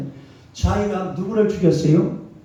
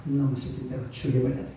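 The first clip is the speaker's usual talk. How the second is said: muttered threat, softer, fast and low; mock threat from Saul; 6 semitones lower, 7 dB quieter, faster than usual. Preacher voicing jealous King Saul vowing to kill David, fast low muttered threat. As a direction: grumble it fast under the breath like a jealous king.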